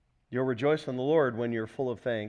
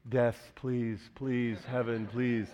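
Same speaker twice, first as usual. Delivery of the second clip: lower, pleading moan of a sick person; mock sick moaning; 4 semitones lower, 4 dB quieter, slower than usual. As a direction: beg weakly like someone laid up with flu. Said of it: "It's okay to lay there going".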